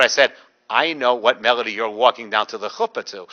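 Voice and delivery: usual speaking voice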